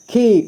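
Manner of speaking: खी with a held ee; long vowel. Shown as a demonstration. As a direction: draw out the vowel of खी. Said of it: "लंबे समय तक खींचेंगे थोड़ा". (खी को लंबे समय तक खींचकर)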